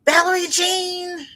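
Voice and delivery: high, drawn-out call upstairs; mother calling out. Retold or imitated. Mother calling from the bottom of the stairs, long high call. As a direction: call the name high and stretched. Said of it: "all I'd hear is".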